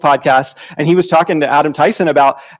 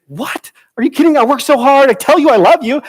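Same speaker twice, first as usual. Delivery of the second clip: high, louder, mock-offended protest; indignant disbelief; 10 semitones higher, 4 dB louder than usual. Pastor protesting to his wife, mock indignant. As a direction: act out his stung surprise. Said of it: "He's like".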